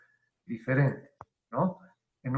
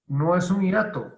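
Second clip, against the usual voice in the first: ordinary talk, nothing marked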